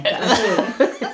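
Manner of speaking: real laugh breaking out; nervous laughter. Real, not acted. Worried travel companion explaining why she laughs, breaking into laughter. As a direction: laugh it out despite being upset. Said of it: "I laugh because I don't know why".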